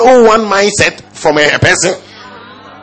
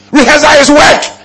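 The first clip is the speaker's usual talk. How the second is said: preaching shout; 9 semitones higher, 4 dB louder than usual. Real, not acted. Preacher hammers his point.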